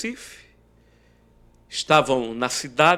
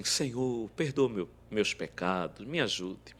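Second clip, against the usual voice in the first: hushed prayer; 3 semitones lower, 7 dB quieter than usual. Retold or imitated. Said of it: "e comecei assim".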